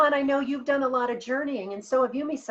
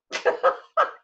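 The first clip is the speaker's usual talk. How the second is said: high breathy burst of laughter at the joke; real burst of laughter; 4 dB louder than usual. Real, not acted. Listener laughing at the retold pet's message. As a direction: laugh out loud, caught off guard.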